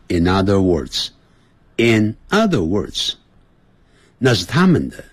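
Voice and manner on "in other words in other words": 慢速重复. Shown as a demonstration. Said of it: "你看".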